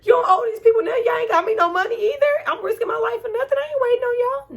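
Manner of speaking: high, loud, fast cheering shout; cheering rant. Retold or imitated. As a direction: yell it like cheering her escape. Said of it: "I was like".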